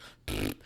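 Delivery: short spluttering raspberry noise; blown raspberry. Shown as a demonstration. Streamer imitating drizzle like spit, a quick blown raspberry. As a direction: blow a quick wet raspberry. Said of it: "trying to, uh, spit at you with a raspberry".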